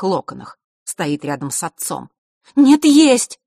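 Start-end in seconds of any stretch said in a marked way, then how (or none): 2.6-3.4 высокий громкий выкрик возражения; возрази криком; возражение. A narrator's reading. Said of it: "возразила Василиса". (громкое возражение)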